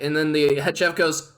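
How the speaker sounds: ordinary delivery, nothing marked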